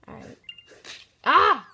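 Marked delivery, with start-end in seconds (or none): 1.2-1.7 high frustrated shout; frustrated yell. Real, not acted. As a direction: yell out at the game. Losing at Flappy Wings.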